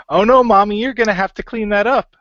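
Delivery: put-on high, loud whiny little-kid voice; loud childish whine at Mommy. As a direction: whine like a kid at his mom. [name] voices a childish plea.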